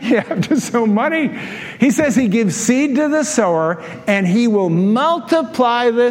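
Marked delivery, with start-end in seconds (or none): none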